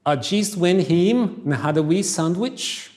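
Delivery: lightly Scottish, slow; a hint of Scottish lilt; Scottish accent. Shown as a demonstration. Teacher reads the last sentence to class with a light Scottish accent. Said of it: "Scottish English".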